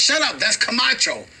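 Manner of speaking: hint of a guard scolding his dog in awe; starstruck border guard. Border guard tells the barking dog to hush, high and starstruck.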